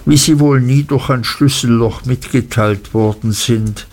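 ordinary delivery

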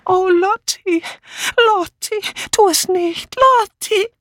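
very high, thin pleading cry; plaintive wail. A narrator's reading. Dora's voice pleading with Lottie from behind, plaintive. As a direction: plead piteously in a high-pitched voice. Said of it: "kläglich Dora Banners Stimme".

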